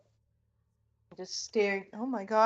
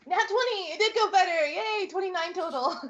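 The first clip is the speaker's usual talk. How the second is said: high, loud cheering over the roll; excited cheer; 10 semitones higher, 8 dB louder than usual. Real, not acted. Natural twenty rolled, cheering.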